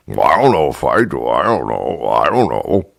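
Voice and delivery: low, dopey bartender playing dumb; stupid voice. Bartender to complaining customer, dopey low voice. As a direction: mumble like you know nothing. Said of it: "talking in that stupid voice".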